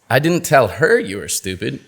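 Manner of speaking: "her" stressed with a rise. Shown as a demonstration. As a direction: hit "her", it was someone else. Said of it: "change the meaning of the same sentence".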